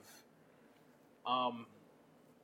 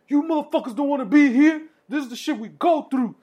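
loud, much higher ranting yell; angry inmate speech; 11 semitones higher, 8 dB louder than usual. Retold or imitated. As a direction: scream at the scared kids.

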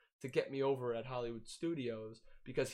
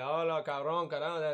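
mock Spanish; 4 semitones higher, 6 dB louder than usual. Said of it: "He'll be like".

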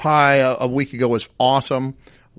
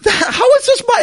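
much higher, louder, laughing incredulity; exasperated disbelief; 4 dB louder than usual. Retold or imitated. Son protesting mom's blame, high exasperated disbelief. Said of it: "It's like".

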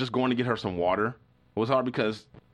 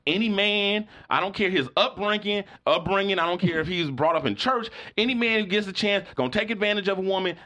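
a much higher imitated voice of a preachy older woman, lecturing; Pip's voice; 8 semitones higher than usual. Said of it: "I'm hearing Pip in my head saying".

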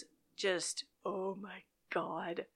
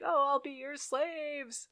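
high, drawn-out fake wail; imitated whiny begging; mock crying plea; 8 semitones higher, slower than usual. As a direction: wail it slowly as a pretend plea for rescue. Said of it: "screaming and crying for help".

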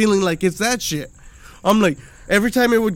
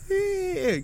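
put-on high, soft baby whine; a tiny toddler squeak; baby noise; 12 semitones higher, 6 dB quieter than usual. Toddler son answering, high baby whimper. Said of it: "He's just like".

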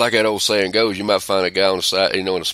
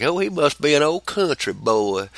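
higher mock voice of people judging his accent; a lightly voiced sneer at the country boy image; mocking country folk; 8 semitones higher than usual. Mocking how others hear his accent, higher sneer. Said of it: "they're like".

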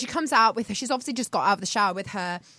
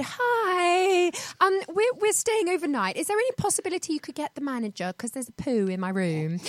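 so polite; 6 semitones higher, 4 dB quieter than usual. Retold or imitated. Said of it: "the way she says it is so polite".

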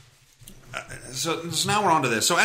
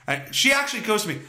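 ordinary delivery

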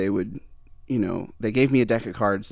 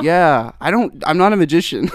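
laughing; 5 semitones higher, 7 dB louder than usual. Retold or imitated. Retelling his awkward reply to judges.

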